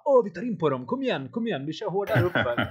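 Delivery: hammed-up rival team cheering each other on uphill; mocking rival team. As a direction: coach mimics the Skyrocket team pushing to close the gap, mild mock excitement. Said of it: "Skyrocket bara".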